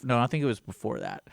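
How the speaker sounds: ordinary talk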